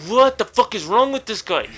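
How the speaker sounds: mild acted exasperation, held short of a yell; angry and bitter. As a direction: voice the bitter thought you couldn't yell. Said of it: "so angry and bitter".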